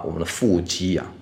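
usual speaking voice

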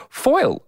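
Foil as one quick high syllable. Shown as a demonstration. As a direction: say Foil without splitting the vowel from the L. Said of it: "I hear one syllable".

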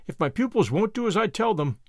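ordinary delivery

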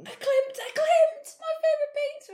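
high, loud squeals of delight; excited. Retold or imitated. Podcaster relives spotting favourite painter's work, loudly excited. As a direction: exclaim it at the top of your voice, thrilled. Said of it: "I was so excited".

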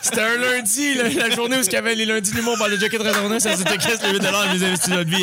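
squeaky exaggerated recap voice; high-pitched mock voice. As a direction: play it high and silly.

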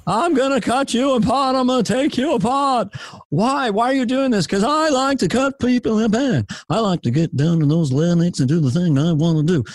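high, whiny sing-song comedian as killer; rhythmic observational-comic menace; Jerry Seinfeld. Acted. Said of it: "Jerry Seinfeld as a serial killer".

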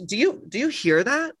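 high, bright teasing buildup; playful excitement. Acted. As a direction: tease the surprise, rising. Staged segment intro, teasing.

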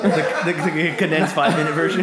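amused, laughing through the teasing question. Real, not acted. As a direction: tease them with a laugh. GM teasing the long story.

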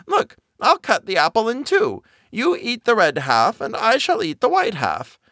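put-on high cackly old woman; sly crone, playing nice; old peasant woman.

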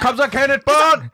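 high, loud cheer; excited shout. Acted. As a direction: call it out like a hype man. Cheering on a mock name.

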